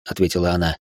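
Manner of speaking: ordinary delivery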